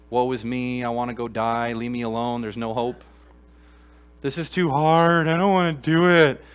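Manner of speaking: mock despairing whine, rising into a loud wail; whiny Elijah. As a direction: ham up Elijah's self-pity. Acting out Elijah's complaint.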